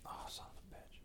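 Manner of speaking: muttered under the breath; whispered curse. Real, not acted. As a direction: whisper it to yourself. Reacting to the long chapter.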